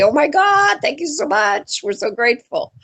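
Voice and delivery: high-pitched gush, settling as it ends; gushing thanks. Retold or imitated. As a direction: gush with gratitude, starting high. Voicing thanks to the board.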